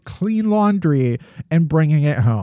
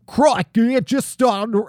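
higher, hammed-up bad Australian accent; attempted Australian accent; 6 semitones higher than usual. Podcaster quotes snake victim, in a badly faked Australian accent. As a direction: have a clumsy go at an Australian accent. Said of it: "try to do an Australian accent".

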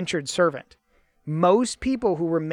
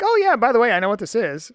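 casual; 5 semitones higher, 4 dB louder, faster than usual. Retold or imitated. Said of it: "you're saying it like".

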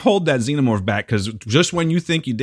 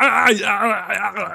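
imitating gasping; 9 semitones higher, much slower than usual. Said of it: "He's like".

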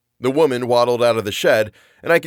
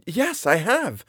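proud confirmation; 9 semitones higher, slower than usual. Acted. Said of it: "I confirmed".

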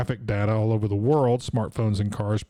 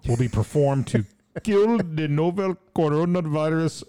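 put-on Indian accent headline; 3 semitones higher than usual. Host reads news headline in a light Indian accent. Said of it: "I don't do an Indian accent".

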